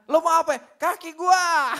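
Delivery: loud, high, comic shout; angry outburst. Retold or imitated. Man whose foot is stepped on, exploding angrily. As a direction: explode in mock indignation.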